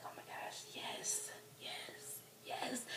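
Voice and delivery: breath-only whispered fangirl rapture; awed whisper. Retold or imitated. Fan hearing the [name] remix, awed whisper. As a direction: whisper it, overcome with delight.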